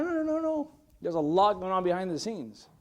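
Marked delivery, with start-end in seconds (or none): 0.0-0.7 high-pitched string of noes; dismissive no-no-no. Real, not acted. Pastor rejects credit for the whole show, high dismissal. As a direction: wave the idea away in a high voice.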